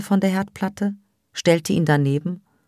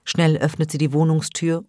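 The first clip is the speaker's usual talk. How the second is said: plain reading voice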